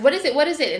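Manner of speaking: the queen panicking, voice up high; freaking out. Retold or imitated. Queen asking in alarm what she sees, panicked. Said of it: "So she's freaking out".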